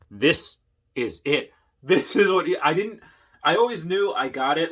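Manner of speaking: the penny finally drops, out loud; excited outburst. Real, not acted. Sudden realization about battery storage.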